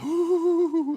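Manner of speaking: a high, loud, thrilled ooh; excited ooh. Retold or imitated. Wife hears about a luxury trip, thrilled high ooh. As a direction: squeal it like the trip is a dream. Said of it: "my wife is like".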